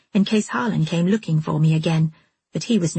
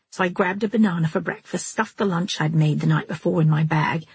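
usual speaking voice

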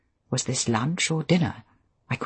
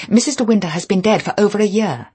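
higher, louder, insistent statement; nervous correction; 6 semitones higher, 7 dB louder, faster than usual. A narrator's reading. New wife telling a caller of a death, flustered and insistent.

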